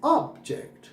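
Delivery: Object said OB-ject, first syllable long; stress on first syllable. Shown as a demonstration. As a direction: lean on the 'ob' of object. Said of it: "अगर पहले पे जोर दिया हमने". (Object में पहले syllable पर ज़ोर)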